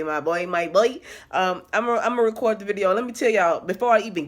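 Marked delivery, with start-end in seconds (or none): none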